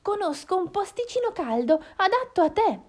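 high-pitched, friendly little voice; small, high hen voice; coaxing little hen. Acted. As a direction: invite a friend along in secret. Hen coaxes fox cub along.

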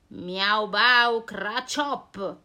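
lentissimo, forte; verso mostruoso. Acted. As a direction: mescola i versi in un grugnito. (il mostro emette il suo verso orrendo)

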